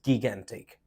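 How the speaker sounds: "gigantic" said gig-AN-tik, hard g first; wrong "gigantic" with two hard g's; mispronounced by spelling. Shown as a demonstration. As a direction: start "gigantic" with the g of "give". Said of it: "It's wrong to pronounce".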